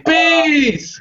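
high, drawn-out comic scream; mock scream.

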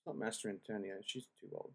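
a private whisper, not meant for the audience; whispered aside. Real, not acted. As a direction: drop to a breathless whisper, muttering to herself. Muttered aside correcting herself.

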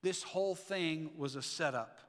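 normal talking voice

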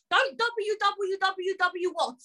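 high, drawn-out mock recital; string the letters out in exasperation; exasperated mocking. Mocking the dictated web address.

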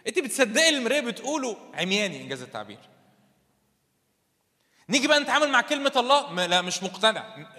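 loud, high, driving sermon voice; fiery preaching. Acted. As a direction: push the point home to the congregation. Preaching at full force. (وعظ بحماس وصوت عالي)

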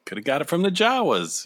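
ordinary delivery

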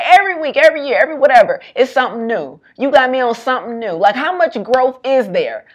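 high, loud hammed-up gripe from the friend; laughing while imitating the exasperated best friend; mock complaining friend.

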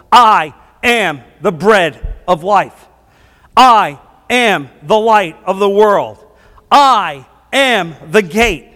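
slow, emphatic declarations, each word set apart; weighty, deliberate preacher's recitation; solemn proclamation. Retold or imitated. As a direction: proclaim each title with a punched opening. Proclaims Jesus' seven sayings.

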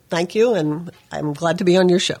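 ordinary talk, nothing marked

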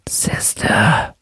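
faint unvoiced whisper; thin whisper. A narrator's reading. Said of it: "a thin voice whispered".

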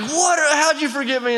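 high-pitched retold outrage; mock indignant outburst. Forgotten fiancée confronting her groom, imitated indignant outburst.

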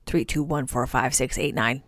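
counting numbers like a robot; robot reading digits, low; toy robot voice. Pretend. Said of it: "We are robots now".

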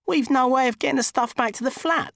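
high, whining fussy man; whiny high man voice. Acted. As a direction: moan it out. Dickie's voice, whining.

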